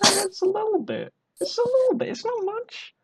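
a hint of a tipsy high-pitched coax; high squeaky coaxing voice. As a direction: squeak it out like a tipsy host talking down a big pour. Drunk pouring a big vodka.

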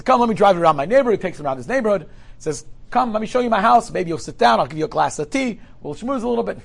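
high and loud, pressing invitation; eager host. Acted. Host pressing the visitor to come see his house, loud and eager. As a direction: sweep him along with enthusiasm.

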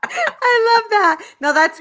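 laughing through the words. Real, not acted. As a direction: laugh as you say it. Laughs about reading her story.

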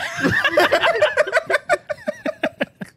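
helpless high-pitched laugh; real laughing fit; high squealing laugh. Real, not acted. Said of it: "Let me stop".

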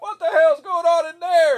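high, loud, slow holler; put-on alarmed yell from the road; passerby calling out. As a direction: imitate someone shouting in from outside. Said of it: "I hear him out there".

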